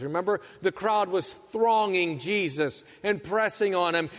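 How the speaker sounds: ordinary talk, nothing marked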